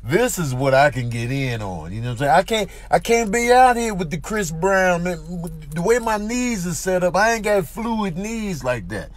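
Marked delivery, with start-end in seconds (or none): none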